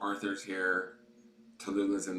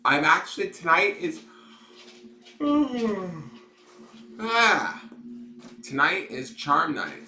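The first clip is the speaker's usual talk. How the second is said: excited; 11 semitones higher, 11 dB louder than usual. Real, not acted. Opening the live show excitedly.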